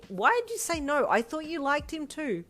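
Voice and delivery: high, loud, baffled protest; exasperated. A narrator's reading. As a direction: demand an explanation in exasperation. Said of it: "her sister yelled in exasperation".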